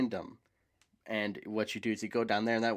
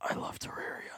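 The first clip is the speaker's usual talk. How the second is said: soft whisper; breath-only, quiet aside; hushed confession; 7 dB quieter than usual. Pretend. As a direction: whisper it like a fond secret. Hushed aside confessing love of game.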